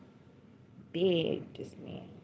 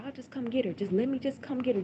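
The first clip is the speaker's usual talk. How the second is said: pleading; 7 semitones higher than usual. Retold or imitated. Mother retells begging to collect daughter.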